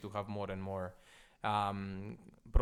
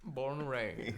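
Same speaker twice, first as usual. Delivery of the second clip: put-on southern accent; slower than usual. Echoes the co-host's southern accent.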